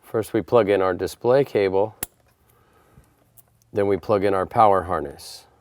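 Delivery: normal talking voice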